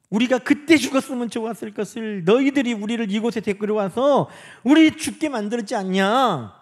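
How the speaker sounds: loud, high accusing protest; resentful complaint. Acted. The people blame Moses for bringing them here, resentful complaint. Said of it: "원망하기 시작했다는 거예요".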